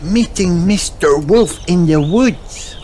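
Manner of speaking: put-on high, slow little girl voice, amazed; drawn out in childish awe; Peppa in wonder. A narrator's reading.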